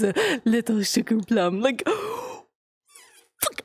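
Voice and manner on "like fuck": high gasp, quiet fuck; embarrassed gasp. Real, not acted. Streamer embarrassed at the whole town knowing, gasping. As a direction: gasp then a soft curse.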